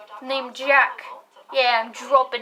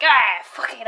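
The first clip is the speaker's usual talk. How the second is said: exasperated outburst; 9 dB louder, slower than usual. Retold or imitated. Imagined giving up outburst.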